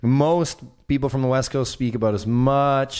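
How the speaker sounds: laughing through the words, slow. Real, not acted. Answering whether he speaks French, laughing. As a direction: chuckle as you answer.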